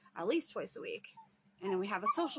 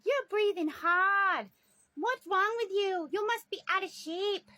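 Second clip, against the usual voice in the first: grandma jeering at her breathless caretaker; mocking grandma; 9 semitones higher, 6 dB louder than usual. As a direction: tease like a bratty little girl. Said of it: "And she's like".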